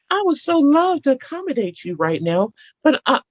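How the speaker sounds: high, sugary polite decline; smiling sweet refusal. Shown as a demonstration. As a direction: smile through the no. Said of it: "you smile when you say it".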